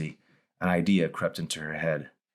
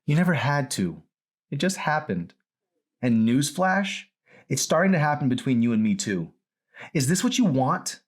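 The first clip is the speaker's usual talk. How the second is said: raised, louder daughter voice; bitter daughter; 9 semitones higher, 4 dB louder than usual. Acted. Daughter warning her father, raised and bitter. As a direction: push back hard, pitched up.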